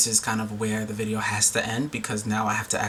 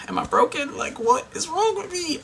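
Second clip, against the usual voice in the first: much higher, strained, half-mocking panic; a hammed-up cry of self-doubt; mock anguished inner voice. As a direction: voice the spiralling worry, pitched way up. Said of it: "And then I started thinking".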